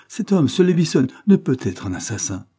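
cry of horror. A narrator's reading. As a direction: recoil in horror at the name. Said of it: "cria-t-elle avec horreur".

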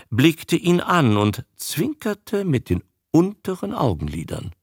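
usual speaking voice

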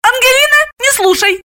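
put-on high-pitched command to a child; scandalised mother, high voice; ordering. A narrator's reading. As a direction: order the little one sharply. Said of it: "и приказала".

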